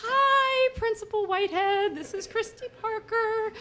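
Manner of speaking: high, loud fake-cheerful greeting; overly bright voicemail voice. Wife leaving voicemail for the principal, high fake cheer. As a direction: put on a chirpy professional front.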